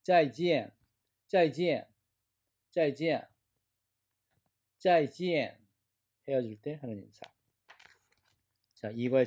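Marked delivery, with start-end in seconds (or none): 0.0-5.6 짜이지엔 high, each half falling sharply; two fourth tones. Shown as a demonstration. Teacher models goodbye for students, 짜이지엔 with double falling tone. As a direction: hit 짜이 and 지엔 as two firm drops. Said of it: "사성, 사성".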